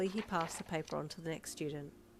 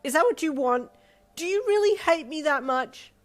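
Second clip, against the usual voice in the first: higher, louder bitter demand; angry; 11 semitones higher, 13 dB louder than usual. Acted. Mother berating her daughter, loud and bitter. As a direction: accuse her with every word.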